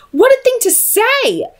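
put-on a young woman's shocked indignation; indignant outburst. A narrator's reading. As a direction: burst out in shock and anger. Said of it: "she burst out indignantly".

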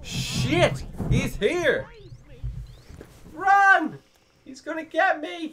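high, loud mock panic; panicked yelling. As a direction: yell in alarm as if being chased. Fleeing a chaser in game.

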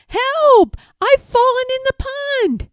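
high-pitched distressed call, drawn high to the end; calling for help. A narrator's reading. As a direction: cry out for rescue. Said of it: "called the voice".

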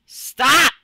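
high loud command; shout. Real, not acted. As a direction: bark it at the screen. Yelling at the game.

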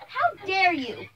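loud, slow, indignant reproach. Acted. Mother confronting the bully on the phone, indignant. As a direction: stretch it out in outrage.

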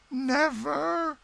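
very high, drawn-out melodramatic refusal; defiant damsel. Acted.

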